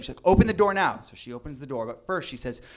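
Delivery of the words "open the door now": loud, firm mom order; stern command. Retold or imitated. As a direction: bang out the demand. Mom demanding door open.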